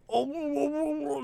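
high, soft panicked cry; a startled gasp underwater; mock panic. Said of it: "I'm like".

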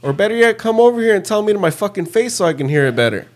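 high-pitched, fast, heated call-out; retold angry challenge. Teammate calling out trash talkers to come over here, heated retold challenge.

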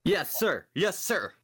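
loud salute. Acted. Player salutes captain.

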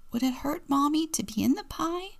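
put-on high child voice, rising; high-pitched wondering kid; little boy's question. A narrator's reading. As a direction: ask it like a small, innocent boy. Story reader voices little Robbie asking.

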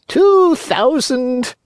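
high, slow cat echo. Acted. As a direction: parrot the fox like a cat. Cat echoes.

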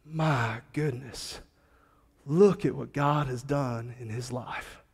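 soft, slow, choked-up awe; moved to tears. Retold or imitated. Old man marvels at a changed life, softly moved. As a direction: hold back tears as you marvel. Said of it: "tears welled up in his eyes".

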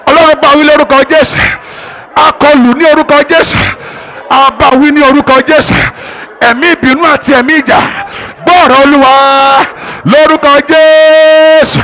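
loud, high, rapid commanding prayer; preaching at full force. Real, not acted. Pastor rebuking the spirit of anger over the church, loud and high.

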